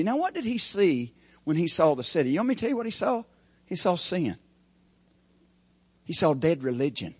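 normal talking voice

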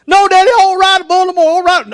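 put-on high, fast little-kid protest; mock scared boy. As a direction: plead with Dad like a frightened child. Imitates scared son refusing to ride.

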